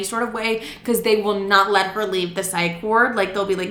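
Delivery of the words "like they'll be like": ordinary delivery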